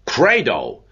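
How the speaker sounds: a lightly voiced roar of faith; lion's shout. A narrator's reading. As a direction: proclaim "Credo!" like a lion in a council hall. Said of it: "shouted out with the voice of a lion".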